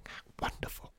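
hushed, whispered marvelling; awed whisper. Acted. Customer peeking behind the scenes, whispering in awe. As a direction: whisper it in wonder.

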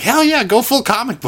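high, loud hyped-up cheer; mock your friend's loud hyped cheer; excited cheer. Retelling the co-host's cheer at the credits, loud and high. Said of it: "You were like".

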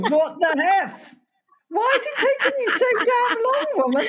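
high, laughing fake indignation; mock outrage. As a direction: scold her jokingly, laughing.

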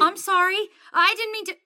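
high, loud, hurried apology; apologetic, voice jumping up; flustered apology. Acted. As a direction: backpedal after causing offence. Hasty apology after offending her.

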